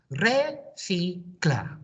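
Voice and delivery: Re, si, cla said as three separate syllables with a gap between each. Shown as a demonstration. Teacher modelling Re, si, cla for pupils to copy syllable by syllable. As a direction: break it up slowly for the class. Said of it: "My turn".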